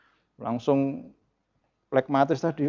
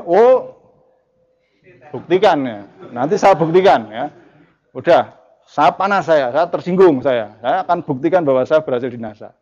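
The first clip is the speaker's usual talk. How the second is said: heated choleric reply; 6 dB louder than usual. Acted. Prospect told he will fail fires back, stung and heated. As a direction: snap back like a provoked prospect, proving himself. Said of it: "orang itu akan panas".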